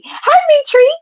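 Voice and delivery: very high squealed hello; excited greeting. Real, not acted. Welcoming Mitri back to the stream, a high squeal. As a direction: squeal hi like you're thrilled.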